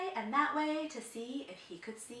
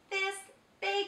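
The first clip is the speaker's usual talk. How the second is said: crying out; 11 semitones higher, 4 dB louder than usual. A narrator's reading. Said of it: "he cried".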